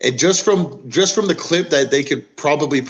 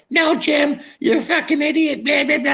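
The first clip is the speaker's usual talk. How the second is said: much higher, whiny nagging wife; 14 semitones higher than usual. Retold or imitated. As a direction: squeal it like a fed-up wife. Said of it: "couples arguing".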